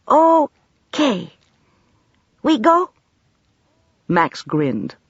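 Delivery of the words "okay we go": put-on child voice. A narrator's reading. Ahmad child voice.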